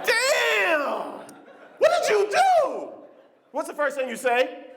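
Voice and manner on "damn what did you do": much higher, slow, appalled cry; disgusted shout. Acted. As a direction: recoil and cry it out, high. Stranger walks into smelly bathroom.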